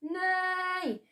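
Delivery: high, soft neigh; a small whinny. A narrator's reading. Billy answering with a soft horse neigh. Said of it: "went Billy".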